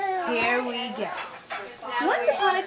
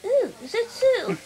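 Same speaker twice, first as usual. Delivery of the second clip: frightened frog; 7 semitones higher, 3 dB louder than usual. Acted. Frog's punchline reaction.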